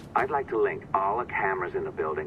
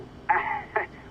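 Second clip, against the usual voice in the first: a short, breathy gloating laugh; 12 semitones higher than usual. Acted. Blackmailer gloats over the hacker with a laugh.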